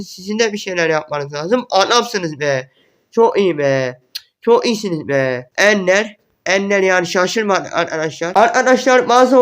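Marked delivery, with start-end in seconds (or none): none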